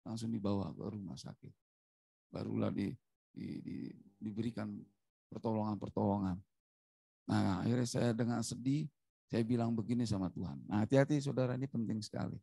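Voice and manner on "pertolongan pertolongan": ordinary delivery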